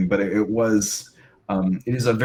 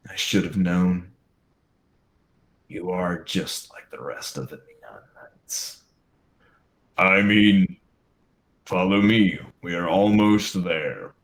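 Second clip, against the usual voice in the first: costumed villain voice. Acted.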